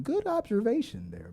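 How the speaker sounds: lightly voiced host giving a mild compliment; imitating John Campea. Streamer mimics John Campea praising a viewer, softly. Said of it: "I'll give you a John Campea comment".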